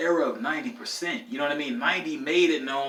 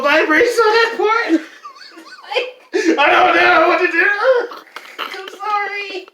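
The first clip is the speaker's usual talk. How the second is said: laughing hard; 12 dB louder, slower than usual. Real, not acted. Laughing telling scary memory.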